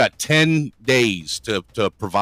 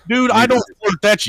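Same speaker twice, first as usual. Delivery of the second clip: indignant; 14 semitones higher, 5 dB louder than usual. Real, not acted. Indignant denial of flirting.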